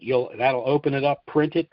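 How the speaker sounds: ordinary delivery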